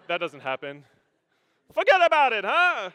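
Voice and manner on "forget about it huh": loud, high New Jersey exclamation; New Jersey accent. Acted. Preacher joking about his road rage, New Jersey accent. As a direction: exaggerate a brash New Jersey accent, shouting. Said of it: "I get a New Jersey accent".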